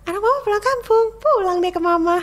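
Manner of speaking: high, loud, sing-song announcement; bright cheery call. Retold or imitated. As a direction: burst in cheerfully to hide the hurt.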